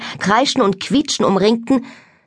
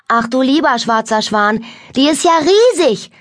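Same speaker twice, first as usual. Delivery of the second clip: higher, louder cry of wonder; amazed exclamation; 6 semitones higher, 4 dB louder than usual. A narrator's reading. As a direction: exclaim it, rising to a peak at the end. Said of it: "rief sie aus".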